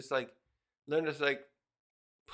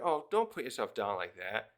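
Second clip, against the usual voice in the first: soft, lower comforting plea; reassuring; 6 semitones lower, 3 dB quieter than usual. Real, not acted. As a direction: gently tell her not to put herself down. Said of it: "I feel a little sad when I see characters put themselves down".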